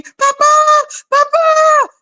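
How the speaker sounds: put-on high, frightened child's cry for papa; scared child calling. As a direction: mimic a terrified little kid calling his dad. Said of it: "so scared of her that he".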